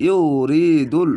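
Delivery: slow chanted ইউরিদুল with long vowels; drawn-out recitation. Shown as a demonstration. Teacher showing the stretched vowel, slow chant. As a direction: sing it out slowly. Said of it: "এক আলিফ টেনে পড়বেন".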